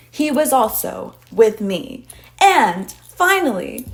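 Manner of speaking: rising to a loud triumphant rant; heated argument. Retold or imitated. Woman proving her case to the angry father, loud building rant.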